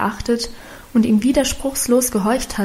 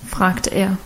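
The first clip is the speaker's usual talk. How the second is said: ordinary delivery, nothing marked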